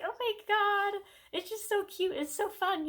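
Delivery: high, excited fangirl squeal; squealing gush. Retold or imitated. Fan gushing over the cute whale scenes, high excited squeal. Quoting her own gushing reaction.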